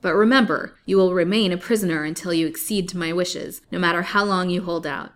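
loud, commanding menace; loud villainous command; menacing threat. Acted. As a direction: lay down the sentence coldly. Colville threatens the captive.

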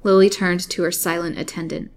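plain reading voice

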